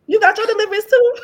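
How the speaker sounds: high, loud, quick teasing through laughter; laughing tease. Retold or imitated. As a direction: tease mom, cracking up. Joking retold reply to mom.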